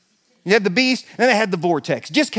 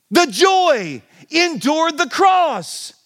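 emphatic preaching; 7 semitones higher, much slower than usual. Real, not acted. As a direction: proclaim it with full force. Preacher stresses key line.

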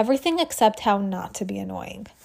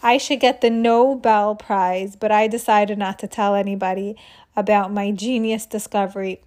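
ordinary delivery, nothing marked

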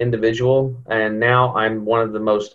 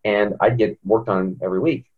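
ordinary talk, nothing marked

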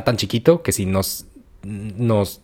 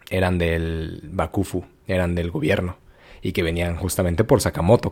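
ordinary delivery

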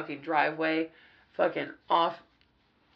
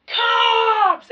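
much higher, loud panicked shout; panicked scream; 8 dB louder than usual. Retold or imitated. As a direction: cry out in sudden panic. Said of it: "screaming that the cops were here".

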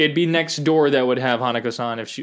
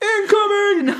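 very high, loud squealing cry; mock alarm yell; 6 dB louder, slower than usual. Demons in hell warning each other, shrieked yell. Hell's crew shouting.

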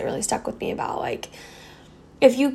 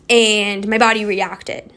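normal talking voice

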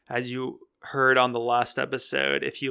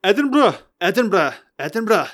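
high, hammed-up Scottish accent, falling sharply; fully commit to a loud mock-Scottish cry; 7 semitones higher, 7 dB louder than usual. Shown as a demonstration. Podcaster trying out "Edinburgh" for listeners, exaggerated Scottish accent. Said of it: "say it in a Scottish accent".

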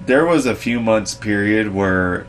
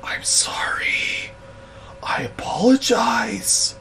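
slow, whispered, pitched up; whispered apology; 12 semitones higher, 7 dB quieter, slower than usual. Retold or imitated. Cowed boss whispering sorry to the woman. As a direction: breathe out a pitiful apology. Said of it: "literally the sound and tone of his voice".

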